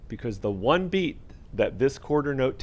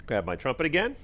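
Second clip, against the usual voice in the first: usual speaking voice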